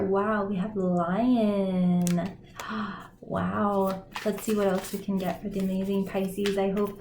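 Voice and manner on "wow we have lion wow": awed wow. Real, not acted. Awed at drawing the lion card.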